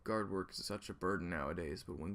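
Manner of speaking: a weary guard on duty; gruff guard. Acted. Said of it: "somebody did overhear you".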